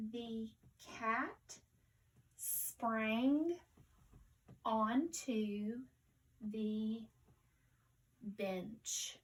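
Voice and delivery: word by word with long pauses; slow reading. Shown as a demonstration. Teacher reading with pupils for the first time, slowly. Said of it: "let's read it slowly first".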